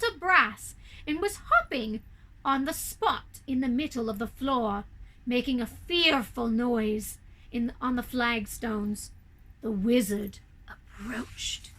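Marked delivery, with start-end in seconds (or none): none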